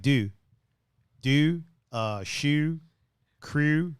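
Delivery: "Do", "shoe", "crew" with a fronted "ew" vowel; Baltimore pronunciation. Shown as a demonstration. Streamer showing chat Baltimore vowels, Baltimore pronunciation. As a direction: push the vowel forward: "Do" as "dew", "shoe" as "shew". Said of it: "that's how I say it in Baltimore".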